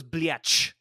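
"Bliatch" said as blee-atch with a ch on the end; mocking the actress's "Bliatch"; botched swear. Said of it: "But she goes".

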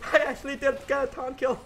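laughing. Real, not acted. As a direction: talk through delighted laughter. Laughing disbelief.

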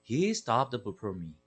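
ordinary delivery, nothing marked

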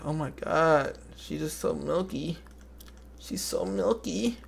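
mock smitten friend. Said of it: "He gonna be like".

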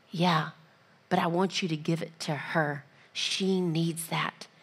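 patiently firm, quiet; God's calm answer; gentle reply. Retold or imitated.

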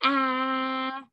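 "ah" held long on a level note; sustained, even "ah" as a phonics demo; short A sound. Shown as a demonstration. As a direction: stretch "ah" like the build-up to a sneeze. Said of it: "like when you're sneezing".

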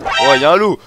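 startled loud exclamation; alarmed shout. Real, not acted. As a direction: cry out at a sudden threat. Spots a wolf.

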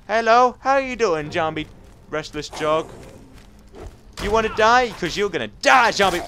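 high, loud mock greeting turning to threat; combat taunting. Real, not acted. Player greeting a zombie in a fight, taunting.

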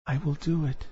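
soft, high little mermaid voice; hushed, small-voiced mermaid; faint resolve. A narrator's reading. Pale mermaid accepts the bargain.

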